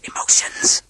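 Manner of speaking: urgent voiceless whisper; hissed whisper. A narrator's reading. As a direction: hiss it under your breath. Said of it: "I hissed".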